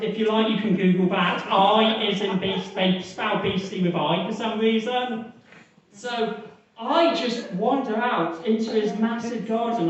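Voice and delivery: ordinary talk, nothing marked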